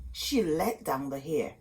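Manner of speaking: ordinary delivery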